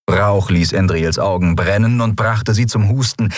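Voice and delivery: usual speaking voice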